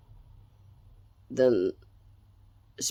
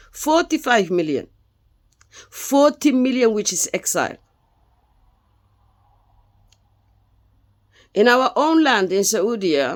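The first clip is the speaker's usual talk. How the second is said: raised, higher voice, pressing each point; impassioned outburst; 7 semitones higher, 3 dB louder than usual. Real, not acted. Speaker to the audience, about his scattered people, rising passionate and loud. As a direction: plead it out loud for the exiled millions.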